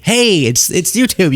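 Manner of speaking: ordinary talk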